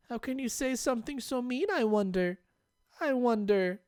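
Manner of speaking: eerie hurt innocence; hurt Rena. Acted. Rena asking Keiichi why he's so mean, high.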